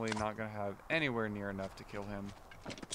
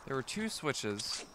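ordinary talk, nothing marked

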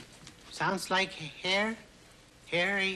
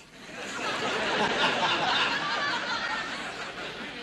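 long high giggling laugh; delighted laugh over the secret. Acted. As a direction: let out a gleeful high laugh. Laughing after the charade.

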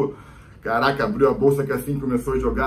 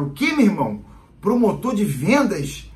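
higher, slow, disbelieving cop voice; mocking cop; 7 semitones higher, 3 dB louder than usual. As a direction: drag out the words in mock disbelief. Cop mocks the friend's answer.

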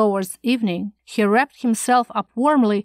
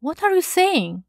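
higher-pitched, disbelieving question; amazed; 6 semitones higher than usual. A narrator's reading. Shocked man questioning his calm companion, amazed. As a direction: ask it astonished, without shouting. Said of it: "amazed at my calm".

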